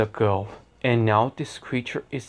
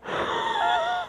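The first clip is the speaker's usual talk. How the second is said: villain laugh; 5 dB quieter than usual. Pretend.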